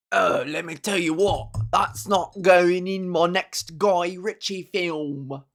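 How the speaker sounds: slow London geezer voice; London gangster. Pretend. Joking about a Guy Ritchie film, in London gangster voice.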